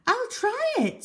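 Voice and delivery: high-pitched, eager neighbour voice; Mrs Silver's voice. Acted. Mrs Silver answers Mr Hoppy.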